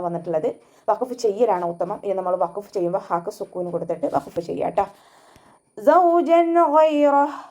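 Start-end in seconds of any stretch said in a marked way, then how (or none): 5.8-7.4 high, slow chanted verse; recitation demo. Shown as a demonstration. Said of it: "അതുപോലെ വഖഫ് ചെയ്യാം".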